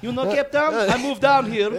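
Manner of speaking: high, loud mock South African accent. Shown as a demonstration. Co-host joining the Cape Town bit, South African accent. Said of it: "This is my South African accent".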